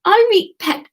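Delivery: high, loud Geordie greeting; chirpy Geordie woman saying hello; Geordie accent. Acted. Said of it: "that woman there is gonna be Geordie".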